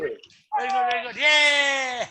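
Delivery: put-on high, loud kid cheer; gleeful kid cheer; childlike cheer. Shown as a demonstration. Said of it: "leading the cheer".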